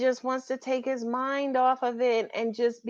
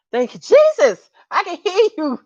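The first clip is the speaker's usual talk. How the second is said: mock relieved praise; 9 semitones higher, 11 dB louder than usual. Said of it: "She's like".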